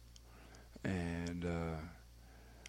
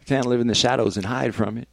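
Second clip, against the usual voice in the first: emphatic; 3 semitones higher, 10 dB louder than usual. Real, not acted. Repeats the line for emphasis.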